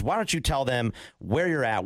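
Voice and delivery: ordinary talk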